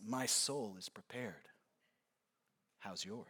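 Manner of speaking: very low, hushed and unmoved; stone-faced calm. Acted. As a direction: say it quietly, chillingly composed. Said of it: "stone-faced".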